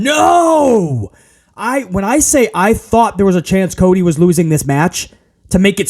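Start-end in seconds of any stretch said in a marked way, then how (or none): 0.0-1.1 high, drawn-out retold "No!"; mock anguished yell. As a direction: wail it at the screen like a dismayed fan. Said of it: "B C was yelling at the screen again".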